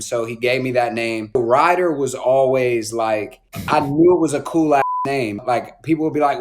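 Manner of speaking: ordinary talk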